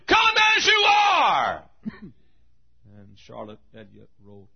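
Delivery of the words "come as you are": long, high, full-voiced shout. Somebody shouting the answer to the lady, drawn-out high shout. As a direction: holler it out, stretched slow. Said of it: "finally somebody shouted".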